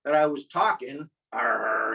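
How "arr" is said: low pirate "arr"; quick pirate interjection. Retold or imitated. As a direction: drop into a low pirate growl. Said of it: "in the pirate voice".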